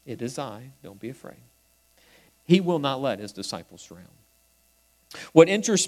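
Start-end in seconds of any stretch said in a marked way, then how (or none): 0.1-1.4 soft and hushed, lower, as Jesus; gentle reassurance. Acted. Jesus comforts his frightened disciples, soft and low. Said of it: "when he tells them".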